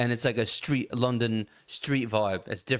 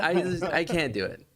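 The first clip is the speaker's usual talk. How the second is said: ordinary talk, nothing marked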